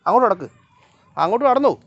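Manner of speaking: loud, high call to a dawdling dog. Real, not acted. Shouting at the dog to walk.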